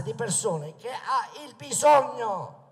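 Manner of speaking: ordinary delivery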